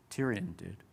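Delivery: usual speaking voice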